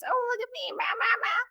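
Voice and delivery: high, mincing mock of a self-satisfied man; mocking the principal. Mocking the principal she's angry at, in a high voice. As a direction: sneer at him with a silly high voice. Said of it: "Fucking Principal Fit Face".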